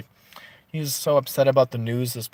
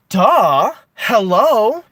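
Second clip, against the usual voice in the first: loud, higher exasperated "duh, hello"; 8 semitones higher, 11 dB louder, much slower than usual. Real, not acted. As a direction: throw it at them like it's obvious. Ranting at the media.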